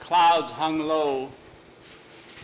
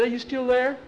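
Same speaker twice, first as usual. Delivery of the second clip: scared call; 10 semitones higher, 5 dB louder than usual. Acted. Boys tied up in cemetery.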